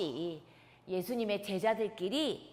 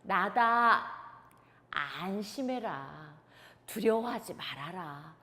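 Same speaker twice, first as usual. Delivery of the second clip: lower, slow reassuring voice; calm Jesus; 6 semitones lower, slower than usual. Acted. Jesus calming his scared disciples, reassuring. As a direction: soothe frightened friends. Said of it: "예수님께서 이렇게 말씀하시는 거예요".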